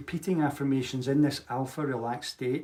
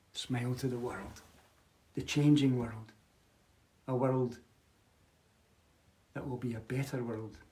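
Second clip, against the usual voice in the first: guided meditation. Acted. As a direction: guide them gently inward, letting it sink. Leads a smiling meditation.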